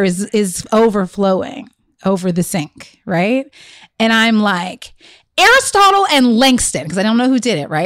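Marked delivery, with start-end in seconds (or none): 5.4-6.9 put-on louder, higher call to the children; mock-summon the kids from downstairs. Mother retelling how she called her sons at the overflowing sink, calling out.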